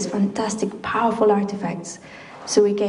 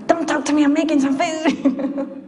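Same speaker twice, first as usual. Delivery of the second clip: mock snap; 6 semitones higher than usual. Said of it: "I used to snap at people".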